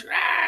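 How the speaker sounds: high mock creature shriek; imitated gremlin scream. Flashing gremlin screaming at a barmaid, imitated high scream. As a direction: let out a brief creature scream. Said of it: "and he, like, screams".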